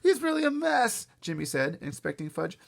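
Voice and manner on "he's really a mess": put-on high, loud kid voice; alarmed boy. A narrator's reading. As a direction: report it like a worried boy. Boy reports injured child.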